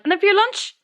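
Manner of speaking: high, building mock-earnest diet pitch; imitating Mary. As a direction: sell the porridge diet like a revelation. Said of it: "And she goes".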